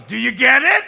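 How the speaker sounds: high, booming loud demand; exasperated God. Acted. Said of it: "even God goes".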